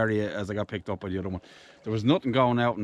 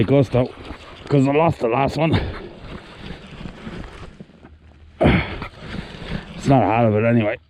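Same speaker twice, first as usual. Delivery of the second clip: raised voice while reeling in a fish; loudly narrating, strained with effort; loud and excited; 6 dB louder than usual. Real, not acted. Fighting a big fish.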